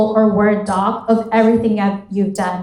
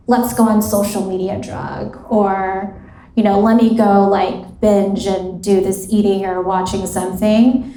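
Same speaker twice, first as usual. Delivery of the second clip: ordinary talk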